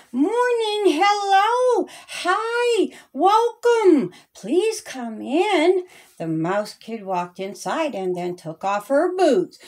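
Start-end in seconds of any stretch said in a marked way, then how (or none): 0.1-5.9 high, eager hostess voice with a little cry; excited greeting. Acted. Mother Mouse welcoming the first kid at her door, high and eager. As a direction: welcome them in, pitched up high.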